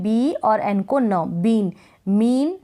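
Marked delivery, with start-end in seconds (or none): 1.4-2.6 बीन, मीन said slowly with a long drawn ee; stretched long ee. Shown as a demonstration. Teacher to students, showing the long ee slowly. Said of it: "बड़ी के रूप में पढ़ रहे हैं".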